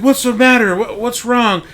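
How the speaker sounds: high, loud, concerned teacher voice; worried teacher. Retold or imitated. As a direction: rush in asking what happened, voice up high. Teacher rushes over to girl.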